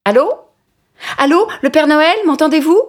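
high eager call down the phone. Acted. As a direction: call out hoping someone answers. Child calling on the phone.

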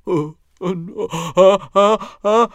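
oh non puis ah aigus et forts; elle ne peut plus le retenir; éternuement qui monte. Acted. Mila va éternuer. (Mila sent venir l'éternuement près de Kali)

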